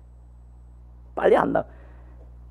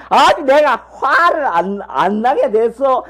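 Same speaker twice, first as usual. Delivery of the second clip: mock nagging wife, much higher and slowed; imitating the wife; 8 semitones higher than usual. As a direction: play the wife's aggrieved complaint. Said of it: "우리 집사람이 이러면".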